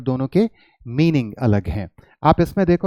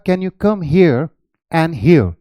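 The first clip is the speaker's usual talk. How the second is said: slow, careful demo sentence; teacher demonstrating a sentence; slow example sentence; 3 dB louder, much slower than usual. Shown as a demonstration. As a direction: say the example slowly and clearly. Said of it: "अगर मैंने कहा".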